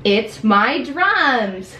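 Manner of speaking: very slow, drawn-out whine; possessive whining. Acted. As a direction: stretch it out like a sulky child. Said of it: "We act out all of them".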